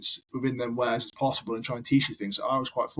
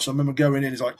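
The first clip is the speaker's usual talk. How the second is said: ordinary delivery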